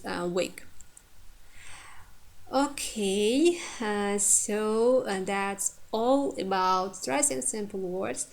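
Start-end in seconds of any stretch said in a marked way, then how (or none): none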